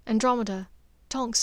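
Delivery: plain reading voice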